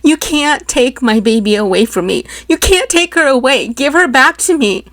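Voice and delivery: put-on mother begging for her baby; desperate cry. A narrator's reading. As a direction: plead through rising despair. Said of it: "I cried".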